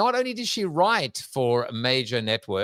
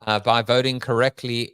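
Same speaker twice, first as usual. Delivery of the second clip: usual speaking voice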